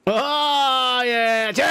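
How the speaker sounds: high, loud happy toast; excited cheer. Real, not acted. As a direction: cheer like you found treasure. Found vape, cheering.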